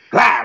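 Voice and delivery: loud, high "blah" of impact; explosive comic splat noise; imitated floor slap. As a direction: mimic a body smacking the floor. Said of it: "I slapped that floor like".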